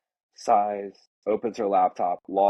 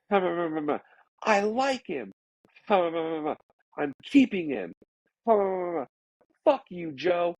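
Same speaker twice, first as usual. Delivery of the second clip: mild mock yell; 9 semitones higher than usual. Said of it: "you hear screaming".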